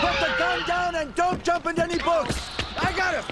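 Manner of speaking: cop shouting. Acted. Cop chasing suspect, calling out.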